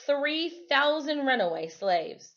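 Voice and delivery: steady read-aloud narration for the class; story reading. Acted. Teacher reads history story aloud.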